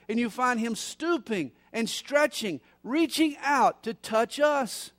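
loud, rising revival preaching; preaching at full force. Acted. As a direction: build it up like a pulpit climax.

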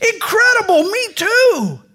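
high thrilled exclamation; delighted, pitch it way up; excited. Acted. Rescuer thrilled they share a faith, high excited cry.

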